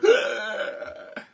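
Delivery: high, drawn-in gasp; shocked gasp. Retold or imitated. Class reacting to teacher's scolding, shocked gasp. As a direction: suck in air, aghast.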